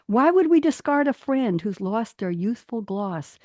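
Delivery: storytelling recital, rising and falling; lilting poem read aloud. Acted. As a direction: read it like a children's verse tale. Reads her teddy bear poem.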